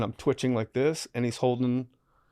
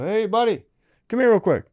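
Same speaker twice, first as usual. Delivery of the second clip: calling out; 9 semitones higher, 4 dB louder than usual. Retold or imitated. Calling son over in prison.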